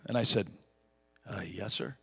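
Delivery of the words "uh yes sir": small, soft, meek reply; timid yes sir. Retold or imitated. Preacher answering the huge man, soft and timid. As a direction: shrink back and answer meekly.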